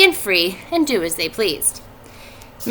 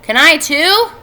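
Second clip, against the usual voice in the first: put-on eager child; 7 semitones higher, 7 dB louder, slower than usual. A narrator's reading. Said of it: "asked Avery".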